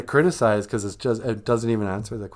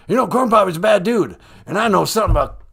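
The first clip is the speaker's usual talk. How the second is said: loud, higher blustering politician; Joe Biden; 7 semitones higher, 5 dB louder than usual. Acted. President rambling to the host about a rival, blustering. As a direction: launch loudly into the old yarn. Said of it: "Mister President".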